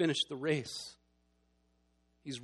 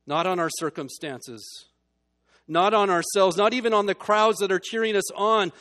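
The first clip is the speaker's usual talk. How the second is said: louder, higher, hammering each phrase; emphatic preaching; 5 semitones higher, 10 dB louder than usual. Acted. Preacher urging listeners to fix their gaze, emphatic preaching. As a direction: drive home where to look.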